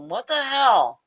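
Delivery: surprised. Retold or imitated. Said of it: "then I go".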